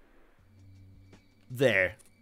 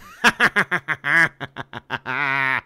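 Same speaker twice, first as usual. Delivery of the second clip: long staccato laugh; real burst of laughter, trailing on; gleeful laugh; 5 dB louder than usual. Real, not acted. Gamer finding the missile launcher he wanted, gleeful laugh.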